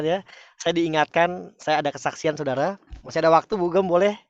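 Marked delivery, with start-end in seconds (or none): none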